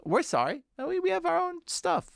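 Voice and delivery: very high put-on celestial voice; the heavens replying. The heavens brush off Elazar's plea in a very high voice. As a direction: answer from way up in the sky, squeaky and dismissive. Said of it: "And the heavens say".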